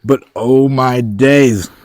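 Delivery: slow, loud, awed oh my days; drawn-out excited exclamation. Real, not acted. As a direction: stretch it out in delight. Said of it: "why I'm so excited right now".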